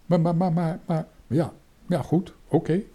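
high, hesitant, stuttering consent; timid stammer. A narrator's reading. Scared boy agreeing to the mission, high stammering. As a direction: stammer a nervous protest, then give in. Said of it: "zei bange Toontje".

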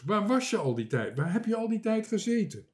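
scolding questions, climbing high; angry call. A narrator's reading. Marinka questions the missing sailor, scolding angrily. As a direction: demand an answer, voice rising. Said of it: "riep Marinka boos".

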